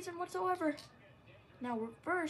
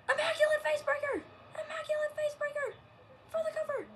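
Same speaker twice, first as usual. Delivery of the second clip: loud, high finisher call; wrestling commentator; 10 semitones higher, 5 dB louder than usual. Acted. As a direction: call it for the crowd.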